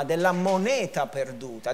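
usual speaking voice

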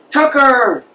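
high, glad call across the day room; calling out a name. Retold or imitated. Old vet spots his friend and calls his name, excited, high-pitched. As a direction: shout his nickname like you're happy to see him.

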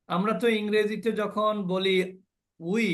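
ordinary talk, nothing marked

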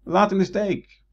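high, loud, slow anguished plea; Narcissus calling out. Shown as a demonstration. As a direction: cry out to the fleeing reflection. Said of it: "Wat moet je niet doen?".

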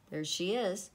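plain reading voice

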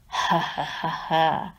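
low, slow villain chuckle; wolf chuckling at the lambs; sly wolf laugh. A narrator's reading. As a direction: laugh slowly like a hungry wolf.

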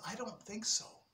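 soft, doubtful whisper. Acted. Little boy doubting the others, whispered. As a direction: breathe it doubtfully. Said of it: "He says".

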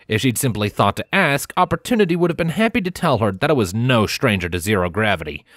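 ordinary delivery, nothing marked

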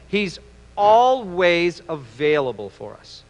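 "always" stretched slowly for emphasis; stress on always. Real, not acted. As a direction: slowly repeat it, drawing out "always". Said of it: "let me say it again".

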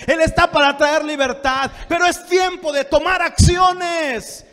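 loud, building full-force preaching. Real, not acted. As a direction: build to a high, urgent pulpit shout. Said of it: "Perdón que levante la voz". (prédica a voz en grito, cada vez más intensa)

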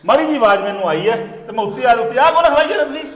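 loud, rising angry call upstairs; furious shout up the stairs. Acted. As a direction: yell out at whoever is there. Calling out to intruder upstairs.

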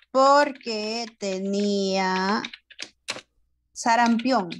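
very slow, drawn-out words with a long pause; slow dictation. Shown as a demonstration. Teacher writes an answer for pupils, very slow dictation. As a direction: compose aloud at writing speed. Makes up answer while writing.

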